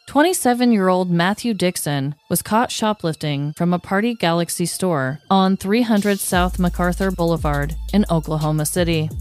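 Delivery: usual speaking voice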